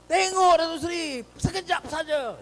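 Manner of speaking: high-pitched pleading call; imitated shout. Youths beg the Datuk Seri to look briefly, calling high. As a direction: call out, begging a moment's attention. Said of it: "dia jerit".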